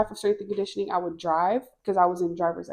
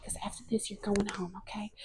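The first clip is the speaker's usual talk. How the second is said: hushed orders; 11 dB quieter than usual. Retold or imitated. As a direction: murmur it confidentially, fading away. Hallucinated girl gives hushed orders.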